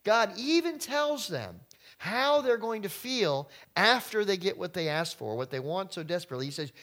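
ordinary talk, nothing marked

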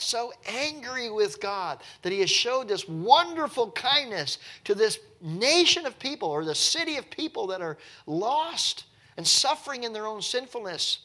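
ordinary delivery

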